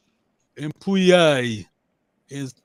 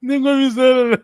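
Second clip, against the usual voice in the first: calling out; 7 semitones higher, 8 dB louder than usual. Real, not acted. Teacher calls on a student.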